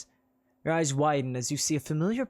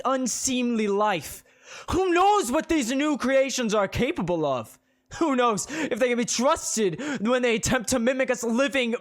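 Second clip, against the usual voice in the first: high, loud preaching; preacher condemning magic creatures; loud condemnation; 8 semitones higher, 5 dB louder than usual. Acted. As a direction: hammer each claim home.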